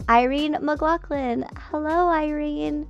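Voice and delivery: ordinary talk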